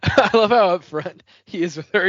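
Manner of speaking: real laughter, high and loud; laughing. Real, not acted. A fan cracking up over the rude character, laughing. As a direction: laugh through the words.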